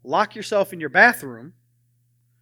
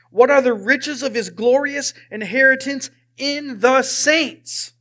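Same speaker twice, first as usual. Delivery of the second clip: slow, declaimed Bible verse; emphatic scripture reading; 3 semitones higher, 4 dB louder, slower than usual. Acted. Preacher reading Ephesians to the congregation, slow and emphatic. Reads the scripture passage aloud.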